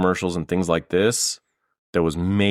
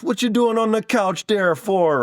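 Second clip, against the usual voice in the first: much higher, scolding Jamaican dad in a hammed-up accent; bad Jamaican accent; 3 dB louder than usual. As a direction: scold the lazy son like a cartoon Jamaican father. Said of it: "forgive him the terrible Jamaican accent".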